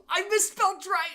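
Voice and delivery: sudden gasp, then high dismayed words; shocked gasp. Real, not acted. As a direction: caught off guard by a mistake. Gasps at own spelling mistake.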